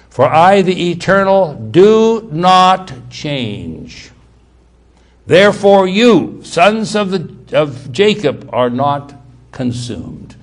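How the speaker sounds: loud, very drawn-out proclamation, easing at the end; scripture reading. Acted. Preacher reads God's statement from Malachi, slow loud proclamation. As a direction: proclaim God's words slowly with heavy weight.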